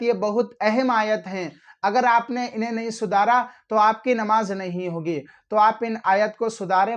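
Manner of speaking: usual speaking voice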